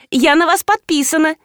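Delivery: high, eager schoolgirl; keen young fan voice; школьница. A narrator's reading. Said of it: "заявила девица". (высокий голос восторженной школьницы)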